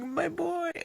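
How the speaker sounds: a light imitation of a sobbing dad; Cedric's father over his son's body, mild mock wail; grieving father. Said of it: "yelling and screaming and crying".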